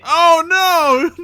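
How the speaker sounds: high, loud laughing oh no; dismayed cry. Real, not acted. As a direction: shriek in mock horror. GM reacts to the failed roll.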